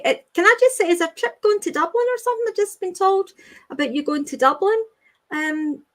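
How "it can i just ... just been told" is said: sudden surprise. Real, not acted. Medium suddenly surprised by a message about Dublin. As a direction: caught off guard by a new message.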